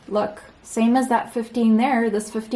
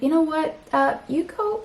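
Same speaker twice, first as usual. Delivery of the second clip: sassy dismissal; 7 semitones higher than usual. Pretend. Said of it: "maybe you're just like".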